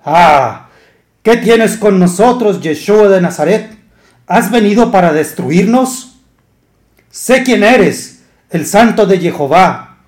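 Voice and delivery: high, loud demonic cry, slowed in places; a demon crying out at Yeshua, high and loud; shrieking unclean spirit. Acted. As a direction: scream it like the possessed man in the synagogue. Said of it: "que dio voces diciendo".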